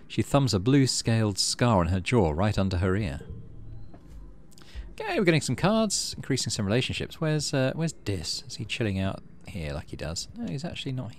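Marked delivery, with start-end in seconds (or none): none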